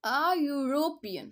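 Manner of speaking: "European" slowly, opening with a "yoo" glide; y-sound demo. Shown as a demonstration. Teacher showing the consonant sound, "European" said slowly as "yoo-ropean". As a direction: stretch "A", then a clear y at the start of "European". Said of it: "वाई की sound".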